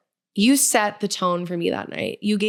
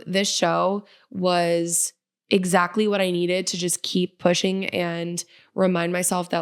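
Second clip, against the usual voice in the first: normal talking voice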